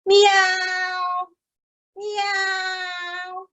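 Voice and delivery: high meow like a cat; a high, drawn-out cat mew; storyteller meowing for the cat. Acted. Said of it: "the cat meowed".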